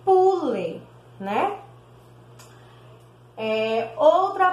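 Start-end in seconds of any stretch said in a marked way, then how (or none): 0.0-0.8 pule with a crisp unvoiced P, higher; P sound. Shown as a demonstration. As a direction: show the P in pule. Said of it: "Se botarmos um P".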